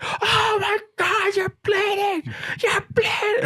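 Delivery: drawn-out falsetto wail; hammed-up, very high mock panic; panicked wife. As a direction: imitate a wife shrieking in horror. Imitates wife's panic at blood.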